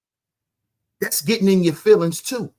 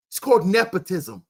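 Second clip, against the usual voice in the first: ordinary delivery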